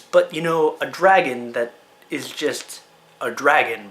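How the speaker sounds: ordinary talk, nothing marked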